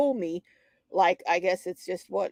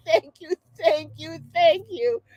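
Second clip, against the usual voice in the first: grateful thanks; 7 semitones higher than usual. Retold or imitated. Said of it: "I said".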